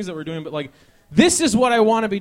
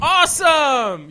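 high, loud, drawn-out mock praise; slow overdone fake enthusiasm; sarcastic awesome; 7 semitones higher, 7 dB louder, much slower than usual. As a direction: drip sarcasm on it. Sarcastic reply about drunk driving.

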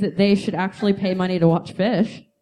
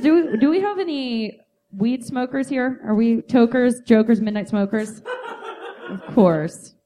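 usual speaking voice

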